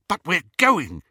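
can't hold back the excitement; exhilarated. A narrator's reading. Snape cheering up Lily on the train, excited. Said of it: "unable to suppress the exhilaration".

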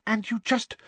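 high, rushed and breathless; asking with held breath, amazed; breathlessly. A narrator's reading. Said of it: "said Hermione breathlessly".

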